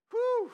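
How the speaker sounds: high, loud whistled whew of relief; mock relieved whew. Preacher mocking a retiree's sigh of relief. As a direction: blow out a big relieved whew.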